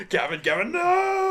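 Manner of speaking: high, loud, mock panic shout; panicked scream. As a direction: shriek no in mock horror. Copying the panicked cry.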